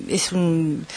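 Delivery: normal talking voice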